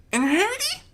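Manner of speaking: very high filly squeak. Acted. Sweetbelle asking about her sister, high squeak. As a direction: squeak it out small. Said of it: "Sweetbelle squeaked out".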